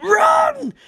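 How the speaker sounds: very high-pitched cry of alarm; mock panicked shout. Mobsters fleeing the gold gun, panicked shout. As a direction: shriek it as a comic alarm.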